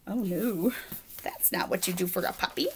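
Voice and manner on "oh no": ordinary delivery, nothing marked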